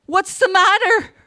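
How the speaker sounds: high, loud, anxious question; breathless alarm. A narrator's reading. Mother asks the officer at the door, breathless and alarmed. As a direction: ask it in a breathless panic. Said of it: "Erda asked breathlessly".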